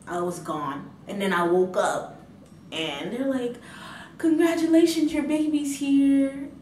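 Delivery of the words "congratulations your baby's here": cheerful announcement. Retold or imitated. Staff announce baby at waking.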